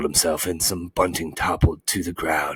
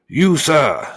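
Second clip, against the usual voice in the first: loud, high indignant bee; excited shout; 9 semitones higher, 9 dB louder than usual. A narrator's reading. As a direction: shout the accusation, pitched up. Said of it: "shouted the bee very excitedly".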